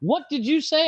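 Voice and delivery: high-pitched mock teacher's challenge; scolding teacher.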